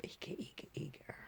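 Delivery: soft whisper of anticipation; hushed eager whisper. Retold or imitated. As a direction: breathe it out like holding your breath in suspense.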